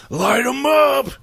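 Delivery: high, slow battle cry; shouted order. Retold or imitated. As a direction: yell the order to open fire. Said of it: "They just start walking up to 'em".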